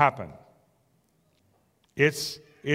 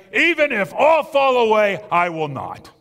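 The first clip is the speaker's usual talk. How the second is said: loud, higher boast that drops on the last words; Peter/character declaration; 5 semitones higher, 6 dB louder than usual. Acted. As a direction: bold vow from an overconfident disciple. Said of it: "But Peter declares".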